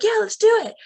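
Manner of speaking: high, loud eager cheer; eager yes. Retold or imitated. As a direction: jump at the plan. Students agreeing eagerly.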